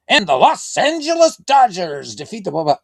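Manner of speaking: high, loud hammed-up play-by-play call; mock announcer bellowing the final score; imitating his announcer call. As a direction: ham up a big game-ending call. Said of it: "it'll be like".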